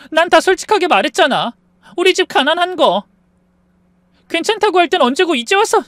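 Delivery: tearful plea, climbing high and loud; crying wife. Acted. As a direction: reproach him through tears. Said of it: "아내가 그렇게 울면서 말하니까".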